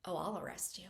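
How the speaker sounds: soft breathy whisper; sultry whisper. Retold or imitated. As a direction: whisper it like a tease. Sheriff flirting while arresting.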